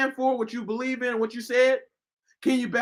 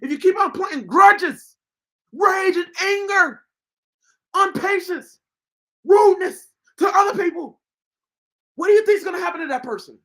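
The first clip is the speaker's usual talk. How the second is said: raised, fervent preacher's call; urgent sermon warning the unforgiving; preaching; 7 semitones higher, 3 dB louder than usual. Acted. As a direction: preach it out at full force. Preaching hard at viewers.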